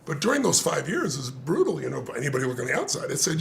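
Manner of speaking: normal talking voice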